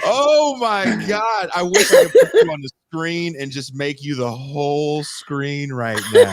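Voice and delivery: loud, high, drawn-out astonishment through laughter; thrilled outburst. Real, not acted. Host thrilled by guest's digression, excited outburst. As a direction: cry it out, overcome with glee.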